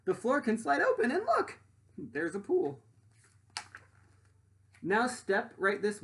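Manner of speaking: lively rhyming storybook read; reading to children. Acted. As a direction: read it out to the class with bounce. Reads picture book aloud.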